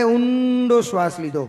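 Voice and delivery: slow, with "ઊંડો" held long. Retold or imitated. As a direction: stretch "ઊંડો" to mimic one long breath. Mimics grandfather's long deep breath. ("ઊંડો" લાંબો ખેંચીને, ઊંડા શ્વાસની નકલમાં)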